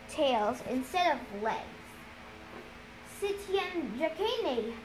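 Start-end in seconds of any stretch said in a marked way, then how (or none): 3.2-4.7 hushed, softer, slow naming; softly voiced murmur of dread names; whispered. A narrator's reading. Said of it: "Annabeth whispered".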